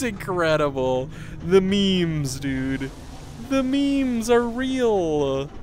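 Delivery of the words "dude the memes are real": ordinary delivery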